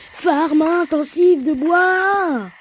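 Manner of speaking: high, loud, very drawn-out battle cry; hyped-up announcement. Pretend. Gamer announcing a wood-farming session to viewers, hyped mock-epic shout. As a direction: belt it out and stretch the last word. Announces what will happen next.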